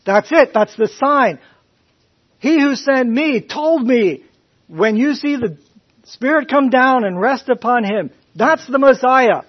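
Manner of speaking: loud, high, excited recognition; John exclaiming. Retold or imitated. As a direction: shout the discovery with joy. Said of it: "and John said".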